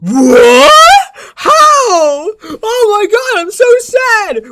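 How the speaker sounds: high, slow, hammed-up wail; fake-sob like a whiny baby; over-the-top mock weeping; mock crying. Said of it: "And then this guy goes".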